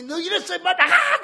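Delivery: mock-roar at him to get out; pretend yell. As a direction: pastor imagines shouting at the man, hammed-up yell. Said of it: "그때 만약에".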